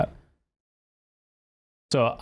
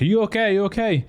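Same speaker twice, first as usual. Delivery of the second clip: much higher, urgent call; retold yelling; 9 semitones higher than usual. Running driver to crash victim, high-pitched retold yell. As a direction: imitate calling out in alarm to someone hurt. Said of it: "I'm yelling at her".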